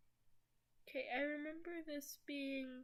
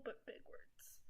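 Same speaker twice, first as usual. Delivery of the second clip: whispering; 12 dB quieter than usual. Real, not acted. Whispered aside.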